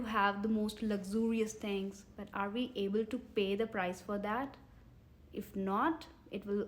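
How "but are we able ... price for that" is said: usual speaking voice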